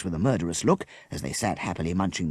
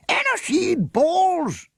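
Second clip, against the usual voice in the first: slow, booming roar, much higher and louder; thundered; 5 dB louder, much slower than usual. A narrator's reading. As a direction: thunder it out in outrage. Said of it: "the leader of the hunt thundered".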